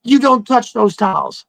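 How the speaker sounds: high mock yell at the roommate; imitating an angry shout. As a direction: snap at him to keep his hands off. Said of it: "she's like".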